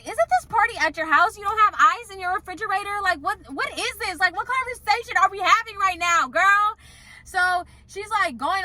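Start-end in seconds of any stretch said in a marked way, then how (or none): 0.0-6.8 high, disbelieving rant at the boss; incredulous. Retold or imitated. Quoting herself scoffing at the boss over ice. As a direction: pitch it up in total disbelief.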